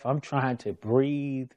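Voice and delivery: plain reading voice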